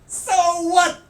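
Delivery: high, loud mock shout of so what; mocking accented shout. The rude guy taunting the teller, high mock shout. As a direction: squawk it like a gleeful taunt. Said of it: "starts going".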